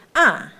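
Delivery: short 啊 without lengthening; short vowel. Shown as a demonstration. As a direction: say 啊 with no long tail. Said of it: "啊的时候没有长音".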